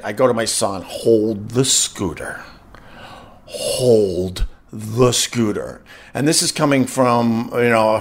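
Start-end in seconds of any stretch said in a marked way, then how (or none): 0.8-5.8 low, very slow, controlled command; stern order. Retold or imitated. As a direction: stretch each word out, low and final. Stern order to son, retold.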